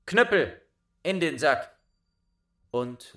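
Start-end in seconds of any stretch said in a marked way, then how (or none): 0.0-1.7 high, loud call to the cudgel; victorious recall shout; shouted command. Acted. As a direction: snap the cudgel back in. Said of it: "dann rief er".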